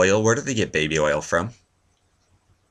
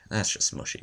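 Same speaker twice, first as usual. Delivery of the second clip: whispered aside; 3 semitones higher, 10 dB quieter than usual. Shown as a demonstration.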